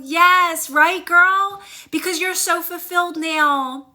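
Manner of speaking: high, emotional praise; real happy tears in the voice; cheering a friend, voice up high; touched, gushing. Real, not acted. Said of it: "this is me getting teary-eyed".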